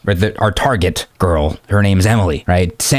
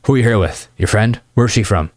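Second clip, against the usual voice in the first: linear, uninflected questioning; boring interview mode. Shown as a demonstration. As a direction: run through questions like a dull interview. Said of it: "very linear and gross and just boring".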